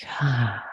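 long, soft "ah" exhale; audible sighing release. Shown as a demonstration. Teacher showing meditators how to release the breath, soft sigh. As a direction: let the breath fall out on a sighed ah. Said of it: "release with a sound".

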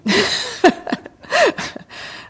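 high burst of real laughter. Real, not acted. Teacher amused at her own gym example, laughing.

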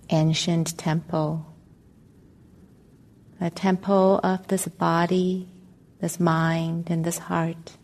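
calm, measured meditation voice with long pauses; guided meditation. Acted. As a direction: guide listeners gently into stillness, leaving space between phrases.

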